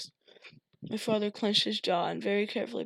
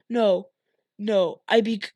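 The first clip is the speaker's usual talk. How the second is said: wrong voice; 8 dB louder than usual. Acted. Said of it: "oh, wrong voice".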